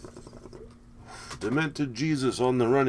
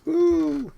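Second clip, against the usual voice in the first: high whoop; 6 dB louder than usual. Real, not acted. Cheering at the happy accident.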